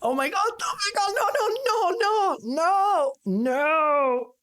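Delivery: high, frantic pleading nos; panicking gamer. Real, not acted. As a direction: squeal no no no in panic. About to lose race to ghost.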